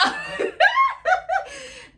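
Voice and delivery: squealing laugh, high and loud; high laughing fit. Real, not acted. Vlogger cracking up at her stalker story, high laugh. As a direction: crack up helplessly.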